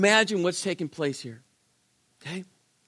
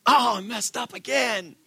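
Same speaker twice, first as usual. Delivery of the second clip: higher exasperated outburst; mock self-reproach, pitched up; acted frustration; 5 semitones higher than usual. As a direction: groan at your own slip-up, acted. Acts out frustration after slipping up.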